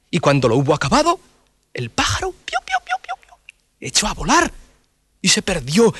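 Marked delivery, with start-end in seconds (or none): 2.3-3.3 high chirping bird sounds; bird chirps. Acted. El pájaro canta. (piar de pájaro agudo)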